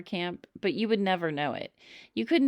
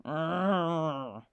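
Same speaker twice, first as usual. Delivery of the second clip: growl. A narrator's reading. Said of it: "growled my dad".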